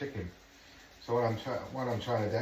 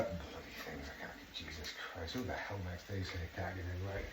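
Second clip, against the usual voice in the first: low, much softer grumbling under the breath; frustrated muttering; 4 semitones lower, 12 dB quieter than usual. Real, not acted. Cook struggling to open a stock pot, muttering to himself. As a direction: mutter curses at the stubborn packet.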